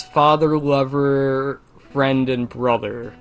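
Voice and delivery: normal talking voice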